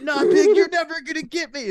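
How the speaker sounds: high, loud taunt; defiant pretend kid. Prankster kid to fake cop, defiant shouting. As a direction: shout it as a cocky kid resisting arrest.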